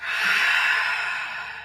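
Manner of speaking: long breathy exhale sigh; deep sigh. Shown as a demonstration. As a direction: let out a deep audible sigh. Yoga teacher demonstrates deep sighs.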